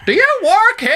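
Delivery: put-on very high, loud old lady; hammed-up granny asking. Old lady asks a waiter if he works there, high and loud. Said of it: "She went".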